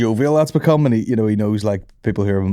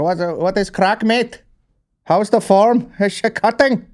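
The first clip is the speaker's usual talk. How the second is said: local Belfast greetings; 10 semitones higher, slower than usual. Retold or imitated. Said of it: "live here".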